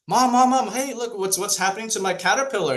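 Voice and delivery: put-on a little boy shouting out in worry; boy alarmed at the cocoon; alarmed kid. Worried kid calls his mom.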